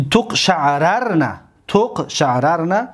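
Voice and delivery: تقشعررن said slowly, both r sounds clear; slow drill. Shown as a demonstration. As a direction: chant تقشعررن slowly twice. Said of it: "conjugation drill".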